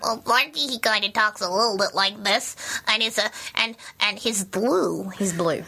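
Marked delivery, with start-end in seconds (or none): 0.0-3.8 lightly voiced odd blue creature; a hint of a goofy puppet creature; puppet Sparky voice. Shown as a demonstration. Puppeteer demos Sparky on request, lightly voiced creature. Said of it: "And how does he talk?". 4.0-5.1 slow, drawn-out puppet creature voice; puppet Sparky voice. Shown as a demonstration. Puppeteer finishing Sparky's line slowly in his voice. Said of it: "And how does he talk?".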